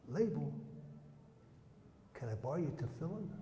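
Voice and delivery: very soft, hushed plea; furtive request. Retold or imitated. A prisoner at the window to a boy, furtive and hushed. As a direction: act out a secret request, barely voiced.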